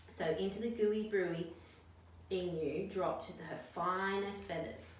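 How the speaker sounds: ordinary delivery, nothing marked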